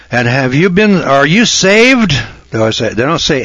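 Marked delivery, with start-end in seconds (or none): 0.1-2.1 high, slow, drawn-out preachy question; preacher's question. Retold or imitated. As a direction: stretch it out like a pious visitor. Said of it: "always ask him".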